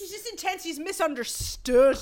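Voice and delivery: high-pitched Irish defence of a heartthrob; Irish accent. Acted. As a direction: plead his case in Irish lilt. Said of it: "your Irish accent is so good".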